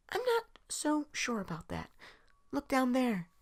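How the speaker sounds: high, soft and secretive; hushed reply. Acted. Companion quietly showing the fountain below. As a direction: lean in and point it out quietly.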